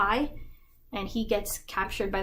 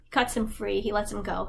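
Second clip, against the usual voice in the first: normal talking voice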